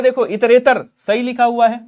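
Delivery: usual speaking voice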